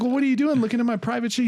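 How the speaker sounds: high, fast, defensive; Ted voice. Retold or imitated. Friend objects to Ted snooping, quick high indignant voice. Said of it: "He's like".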